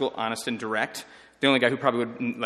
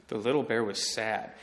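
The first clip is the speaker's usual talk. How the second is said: soft, lower, read like a sad picture book; storybook sad; 4 semitones lower, 4 dB quieter than usual. Shown as a demonstration. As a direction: read it to kindergartners with a hint of sadness. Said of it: "how you read a kid's book".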